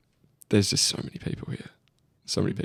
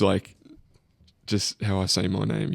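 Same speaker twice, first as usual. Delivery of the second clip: ordinary talk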